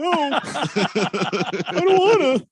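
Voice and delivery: high, squeaky pretend fear breaking into laughter; mock frightened refusal. Caller told to turn around, mock frightened refusal. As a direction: refuse like a hammed-up scared movie victim. Acts scared reply in movie skit.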